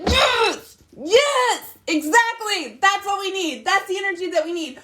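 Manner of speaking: high, bright cheer of Yes; excited cheering. Retold or imitated. Mom cheering her son's first comeback, excited and high.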